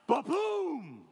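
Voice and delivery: shouted ba-boom, loud and high; thunder sound. Retold or imitated. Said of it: "It was like".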